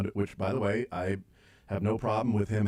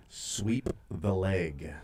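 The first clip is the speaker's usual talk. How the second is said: very slow, softer mock-menacing order; mock sensei command; 4 semitones lower, 4 dB quieter, much slower than usual. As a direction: draw out each word like a stern sensei. Acting out a film sensei's order.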